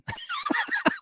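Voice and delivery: soft high squeal of a laugh; high wheezing laugh. Real, not acted. Laughs at the punchline.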